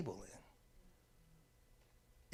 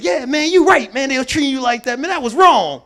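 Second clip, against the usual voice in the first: much louder, higher, commiserating friend; sympathizing friend; 8 semitones higher, 13 dB louder than usual. Retold or imitated. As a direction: egg him on loudly. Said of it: "I go to my friend like".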